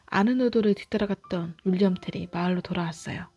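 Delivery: ordinary delivery, nothing marked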